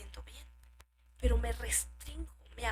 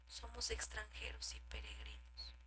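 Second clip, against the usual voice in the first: hushed whisper; 12 dB quieter than usual. Acted. Preacher drops to whisper.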